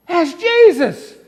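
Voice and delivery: loud, high, drawn-out cry; excited recognition. Acted. Peter spotting Jesus across the sea, excited shout.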